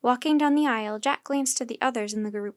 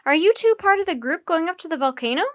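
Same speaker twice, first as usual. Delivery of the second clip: calling out; 6 semitones higher, 6 dB louder, faster than usual. A narrator's reading. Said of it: "she called".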